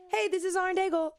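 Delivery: high-pitched radio liner greeting; squeaky, put-on radio hello; going up high. Shown as a demonstration. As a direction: pitch the station ID way up. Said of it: "why do I always go up".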